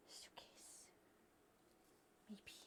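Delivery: unvoiced whisper. Real, not acted. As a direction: breathe the word with no voice. Whispered aside.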